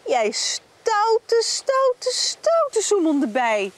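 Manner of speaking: mock stern telling-off, rising; playful scolding. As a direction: scold the naughty bee playfully. Scolding the naughty bee.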